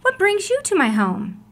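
high, lively surprised question; exclaimed question to the officer at the door; exclaiming. Acted. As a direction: ask it with eager surprise. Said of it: "Amu exclaimed".

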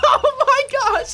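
very high, loud laugh-cry; laughing shout. Real, not acted. Gamer flipping over on the sled, laughing loudly. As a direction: shriek through the laughter. Flipping while bomb sledding.

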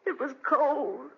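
slow, hollow and stunned; stunned grief. Acted. Mother telling husband their son died, numb grief. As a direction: let the horror sink in slowly. Mother finds her dead child.

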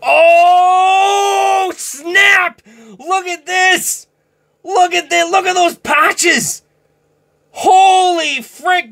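can't believe the pull; real high-pitched excitement, slow on holy frick; thrilled shouting. Real, not acted. Pulls a patch card, thrilled.

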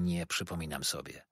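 low, soft reply; subdued young guest; timid boy. A narrator's reading. Shy boy answers the waiter.

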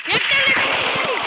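high, loud drawn-out complaint; outraged shout. Real, not acted. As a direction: yell the accusation at a cheating opponent. Accuses rival in heated game. (गुस्से में ज़ोर से चिल्लाकर)

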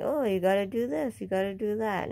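a high, mocking "Oh, you gotta do this" mimicking busybodies; mocking quote. Mimicking strangers on Facebook giving unwanted advice, mock high voice. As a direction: lightly mimic the know-it-alls, pitch up on "Oh". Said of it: "strangers going".

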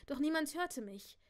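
ordinary delivery

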